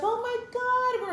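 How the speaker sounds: high, drawn-out friend's squeal; excited greeting. Retold or imitated. Former colleague greeting an old friend, imitated excited squeal.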